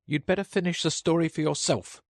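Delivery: high, sulky little Dormouse voice. A narrator's reading. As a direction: sulk like an offended small creature. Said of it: "the Dormouse sulkily remarked".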